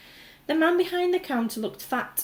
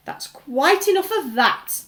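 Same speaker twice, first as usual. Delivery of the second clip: louder, slow firm shout; 6 semitones higher, 8 dB louder, slower than usual. A narrator's reading. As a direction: shut the crowd down. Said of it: "the fat shopkeeper shouted".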